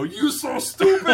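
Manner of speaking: very high, loud hammed-up taunt; mock movie screech. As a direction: screech it like an over-the-top film character. Quotes UHF at his kids.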